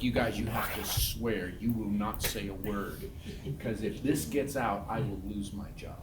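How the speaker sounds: low, hushed earnest plea; confidential swearing-in. Retold or imitated. Swearing students to secrecy, confiding.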